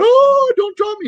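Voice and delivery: hammed-up scream of a man hung from a helicopter; mock terrified plea. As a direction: squeal like a man dangling upside down.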